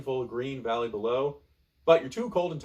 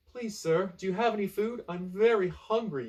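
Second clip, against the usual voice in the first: much higher, timid begging; pleading castaway; 8 semitones higher than usual. Acted. Castaway begs the pirate for food, high and timid. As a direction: plead like a hungry kid. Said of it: "you say".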